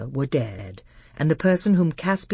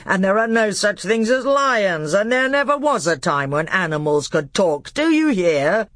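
louder, higher kingly outburst; furious; 7 semitones higher, 5 dB louder than usual. Acted. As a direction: tirade boiling over into a shout. King's tirade reaches its peak.

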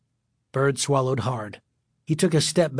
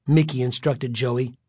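ordinary delivery, nothing marked

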